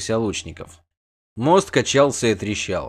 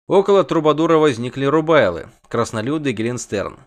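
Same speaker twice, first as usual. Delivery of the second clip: usual speaking voice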